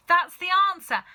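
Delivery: high, loud triumphant shout; excited shout. A narrator's reading. Mister Cranky solving the puzzle, loud excited shout. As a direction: blurt out the discovery. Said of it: "cried Mister Cranky".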